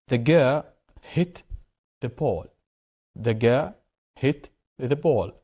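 slow, measured example sentence; slow reading aloud. Shown as a demonstration. Teacher reading an example to the class, slow and deliberate. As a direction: read it out slowly for learners.

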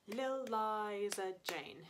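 Lil stretched long, Liza Jane slow; longer Lil. Shown as a demonstration. Teacher correcting rhythm, stretching the first word. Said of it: "that lie is a bit longer".

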